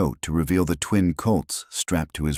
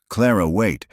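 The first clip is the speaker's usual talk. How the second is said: a mild narrated call, slightly raised; shout; 5 semitones higher, 3 dB louder than usual. A narrator's reading. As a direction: call out after her, only half-shouted. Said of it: "Wyatt's shout of".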